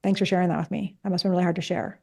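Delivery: pause, then receive it quietly and gently; calm validation. Acted. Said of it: "to pause and be like".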